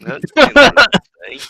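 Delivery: a loud high burst of surprise; surprised. Real, not acted. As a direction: react loudly to something shocking. Shocked reaction to grim tale.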